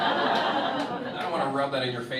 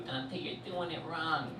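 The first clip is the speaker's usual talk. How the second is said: put-on mean girlfriend; 2 semitones higher, 5 dB quieter, slower than usual. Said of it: "She goes".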